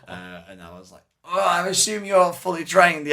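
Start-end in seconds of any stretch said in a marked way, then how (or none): 1.2-3.1 high, loud, slow anxious question; acted alarm. Wounded patient to child surgeon, acted alarm. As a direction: play the worried patient, pitched up and loud.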